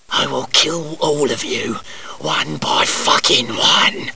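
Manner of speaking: low, soft killer's threat; menacing whisper. Pretend. Teasing his mates with a sinister whispered threat. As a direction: breathe it out like a slasher villain. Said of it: "I'm just kidding".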